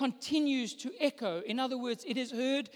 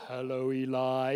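very low, slow booming voice; extremely deep bass voice; 11 semitones lower than usual. Retold or imitated. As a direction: drop as deep as you can. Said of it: "make the deepest voice I can".

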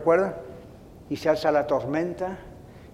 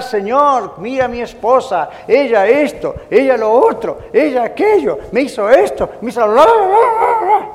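loud, higher tattling prayer rising into blah-blah; mock nagging complaint; 5 semitones higher, 7 dB louder than usual. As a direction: mimic your own whiny list of grievances to God. Mock complaining prayer about wife.

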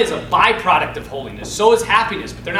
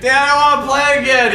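higher, louder mock child's plea; pleading kid; 7 semitones higher, 7 dB louder than usual. Boy asking dad to play, imitated loud plea.

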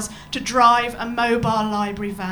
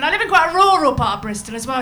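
ordinary talk, nothing marked

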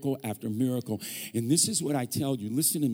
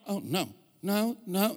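passive-aggressive denial; 5 semitones higher, slower than usual. Retold or imitated. Wife's passive-aggressive denial acted.

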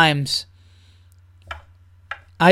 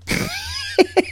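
high squeal laugh; 5 dB quieter than usual. Real, not acted. Laughing at own impression.

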